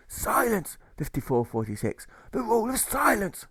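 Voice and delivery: gruff pirate jailer shouting silence; jailer barking, breathing heavily. Acted. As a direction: bark the rule like a strict old jailer. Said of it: "the jailer says".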